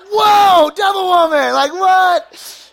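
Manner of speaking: high, loud hammed-up yelp; mock alarm. Reacting to mom's hello, mock horrified shriek. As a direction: recoil in a shrieked protest.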